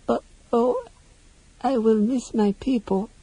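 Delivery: high, small, sorrowful voice; brokenly. Acted. Creature torn between friend and her people, brokenly. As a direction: let it break in a little high voice. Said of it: "she said brokenly".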